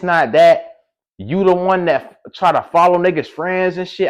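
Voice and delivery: high, forceful comeback; worked-up retold argument; retold heated accusation. Retold quarrel on the phone.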